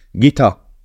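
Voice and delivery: loud, high desperate shout; frantic cry over the noise; shouting Gita's name. A narrator's reading. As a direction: call out to her across the crowd. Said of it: "kiáltotta Lale".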